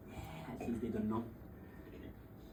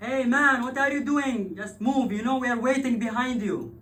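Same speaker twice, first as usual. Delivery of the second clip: much louder, higher shout across the road; yelling driver; 12 semitones higher, 13 dB louder than usual. Retold or imitated. Driver stuck behind a stopped car, angry shout. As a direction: call out angrily from the car window.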